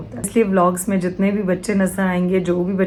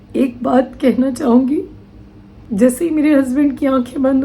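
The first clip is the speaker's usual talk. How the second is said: higher, halting voice welling up with grief; grief-stricken, pitched up, with long pauses; real tearful upset; 5 semitones higher than usual. Real, not acted. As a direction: hold back tears, pausing between phrases. Tearfully recalls being abandoned after husband's death.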